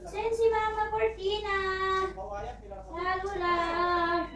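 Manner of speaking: high, drawn-out sing-song calls. Real, not acted. Mother calling to fussy baby. (mataas at malambing na pag-alo sa sanggol)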